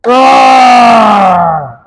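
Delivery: long loud roaring shout; bear roar. Real, not acted. Player roars for the coach in the bear drill, loud shout. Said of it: "Who's the bear?".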